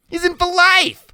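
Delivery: very high, loud protest; Loretta, insisting. Acted. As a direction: argue back in Loretta's high voice. Loretta argues with Iggy.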